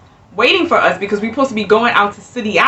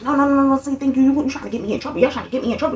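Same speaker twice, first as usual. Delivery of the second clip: softer, hammed-up mock protest; dramatic clerk; 4 semitones higher, 6 dB quieter, much faster than usual. Clerk dramatically warns couple not to get her in trouble, hammed-up. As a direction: play it overdramatic. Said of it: "she's being so dramatic".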